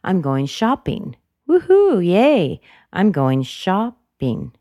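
cheering mid-way, high and loud; celebrate, with emphatic falls on "shopping"; gleeful demo of an exciting topic; excited. Shown as a demonstration. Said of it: "maybe it's an exciting topic".

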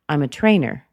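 "I'm a trainer" with TRAINER hit hard, then dropping; a bit harsh, sharp fall on "trainer"; strong, sudden drop. Shown as a demonstration. Answering what do you do, a little too strong. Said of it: "that's a little bit strong".

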